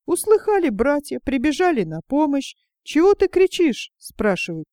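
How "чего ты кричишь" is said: higher and louder, startled; alarmed question. Acted. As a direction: demand to know what the racket is. Brothers run up alarmed. (встревоженный вопрос, выше и громче)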